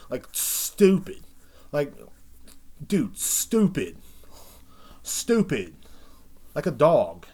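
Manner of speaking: loud, slow, drawn-out stupid; emphatic ranting. Real, not acted. Host ranting about the kids, stretching out stupid loudly. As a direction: lean hard on each stupid.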